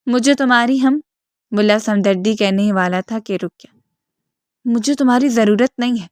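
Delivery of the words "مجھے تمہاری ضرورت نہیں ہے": ordinary delivery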